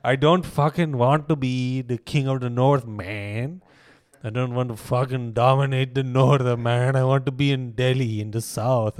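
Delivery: loud hammed-up Indian accent; mock Indian accent. Riffing to friend on Indian Jon Snow, hammed-up Indian accent. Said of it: "If Jon Snow was Indian".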